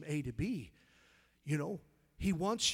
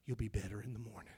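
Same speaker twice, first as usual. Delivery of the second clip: low, soft fatherly voice of God; God's voice; 4 semitones lower, 6 dB quieter than usual. Retold or imitated. Preacher voicing God telling him to go to bed, low and soft. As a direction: speak it low and gentle, like a father. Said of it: "He said".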